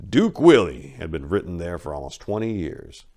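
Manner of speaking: ordinary delivery, nothing marked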